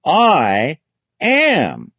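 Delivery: "I" and "am" each stressed, high, with a pause between; emphatic declaration. Retold or imitated. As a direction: set "I" and "am" apart, pitched high and weighty. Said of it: "used this very emphatic expression".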